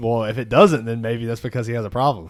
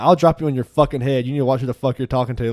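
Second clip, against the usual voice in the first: louder imitated threat; cussing threat; 7 dB louder than usual. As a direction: bark a drunk threat at the guy. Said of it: "Sean is fucking cussing us".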